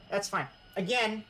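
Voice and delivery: usual speaking voice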